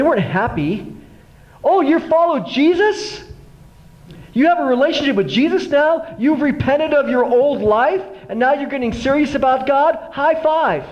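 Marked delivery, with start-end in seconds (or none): 1.6-10.8 sarcastic mockery. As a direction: taunt him as if congratulating. Parents mocked his new faith.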